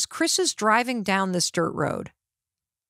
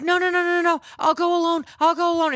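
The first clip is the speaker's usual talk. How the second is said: frantic protest; 8 semitones higher, much faster than usual. Retold or imitated. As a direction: insist desperately, pitched way up. Mother frantically refuses help.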